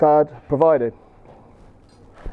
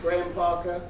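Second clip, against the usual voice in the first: flight attendant; 10 dB quieter than usual. Pretend. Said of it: "his very own address to the passengers".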